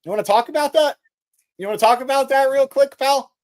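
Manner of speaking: mock tough challenge. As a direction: call him out like a fake tough guy.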